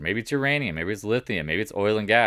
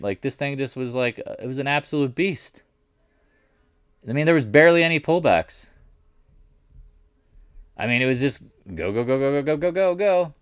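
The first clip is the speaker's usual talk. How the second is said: high, excited build; carried away, pitch rising on a rapid run; getting excited; 7 semitones higher, 4 dB louder than usual. Real, not acted. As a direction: marvel at it, climbing higher and higher. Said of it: "I'm just kinda getting excited".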